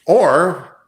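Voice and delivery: normal talking voice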